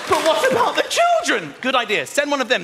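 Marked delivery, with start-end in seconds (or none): 0.1-1.5 high, loud shocked wife; alarmed wife. Retold or imitated. Wife protesting about the children, shocked and loud. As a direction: gasp it out as the horrified wife.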